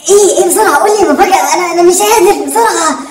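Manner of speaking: high, urgent begging; impatient excitement. Acted. Son demanding father's surprise, excited and impatient. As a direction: press him eagerly for the answer.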